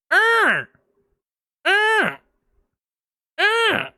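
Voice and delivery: high, flat siren drone; imitated alarm. Retelling a convention fire alarm, high mock siren drone. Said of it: "it starts going".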